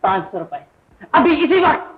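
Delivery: high, loud outburst; angry demand. Acted. Brother demanding money from his family, angry shout. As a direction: fire it out as an impatient order.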